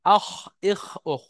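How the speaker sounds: আহ, ইহ, ওহ each ending in a scraped kh; kha articulation demo. Shown as a demonstration. Teacher demonstrates kha with sukun, demonstrating. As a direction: close আহ, ইহ, ওহ on a throaty kh. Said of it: "ঠিক ওরকম ভাবে আপনি খ কে নেন".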